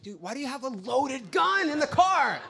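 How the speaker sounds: high, exasperated yelling; incredulous shout. Acted. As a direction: demand an answer, climbing into a yell. Acted outburst at loaded gun.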